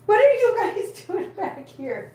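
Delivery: high-pitched, drawn-out pet scolding; exasperated calling out. Real, not acted. Cat carer to screaming cats, exasperated calling out. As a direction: call across the room in a stretched high voice.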